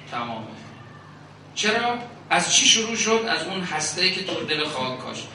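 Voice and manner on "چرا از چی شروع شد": sharply higher, louder questions; rhetorical challenge. Real, not acted. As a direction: push the question at the crowd. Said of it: "rhetorical questions to congregation".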